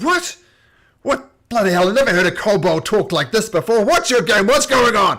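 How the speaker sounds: loud, high, flustered yelling; outraged shout. Acted. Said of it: "he says".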